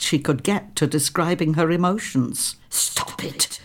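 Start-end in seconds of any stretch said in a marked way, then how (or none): 2.7-3.6 hushed self-command. A narrator's reading. Pippa ordering herself to stop grieving, hushed and firm. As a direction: stop it, barely voiced, a firm hush to herself. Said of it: "she commanded herself".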